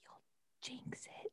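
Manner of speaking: whispered question. Pretend. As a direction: ask in a hushed whisper. Echoes in a whisper.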